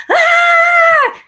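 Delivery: shrill imitated bird shriek, very high and loud; high loud mock bird scream; panicked bird squawk. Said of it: "he's panicking and he's like".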